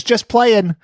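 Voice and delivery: high, loud jokey doctor; mock doctor voice. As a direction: play the doctor teasing the patient. Doctor voiced in retold joke.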